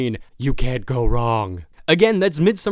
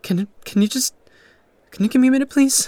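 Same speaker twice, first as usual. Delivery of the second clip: pleading outburst; 10 semitones higher, 4 dB louder than usual. Acted. Groom overwhelmed by questioning guests.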